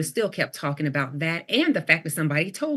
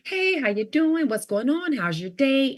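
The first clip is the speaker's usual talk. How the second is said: high, bright and upbeat, slower hello; friendly greeting; 8 semitones higher, 4 dB louder, slower than usual. Shown as a demonstration. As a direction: show her usual cheery check-in. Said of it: "Usually, I'm".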